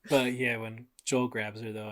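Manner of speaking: ordinary delivery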